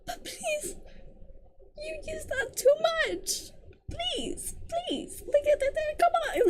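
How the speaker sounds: high, small whining voice; exasperated pleading. Real, not acted. Reader begging the author to stop overusing a word, high pleading. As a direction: beg in a thin high whine.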